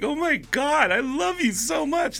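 high, gushing fan voice; mock gushing fan girl. Girl fan gushing at comedian after show, high and gushing. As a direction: gush like a starstruck fan.

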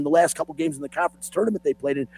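normal talking voice

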